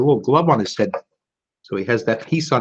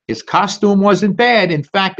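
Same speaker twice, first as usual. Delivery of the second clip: plain reading voice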